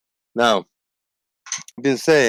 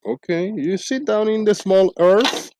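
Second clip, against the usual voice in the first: normal talking voice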